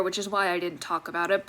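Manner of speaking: ordinary delivery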